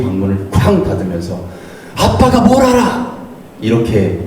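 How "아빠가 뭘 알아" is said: high-pitched adolescent outburst, acted; mock teen shout, pitched way up; son's angry yell. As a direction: play a teenage son yelling back at his father. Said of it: "소리칩니다".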